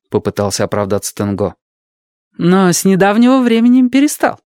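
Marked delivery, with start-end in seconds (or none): 2.4-4.4 knowing nurse. Acted. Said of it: "уточнила сестра Тамура".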